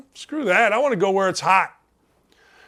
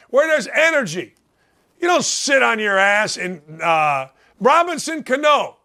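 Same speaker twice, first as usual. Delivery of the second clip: normal talking voice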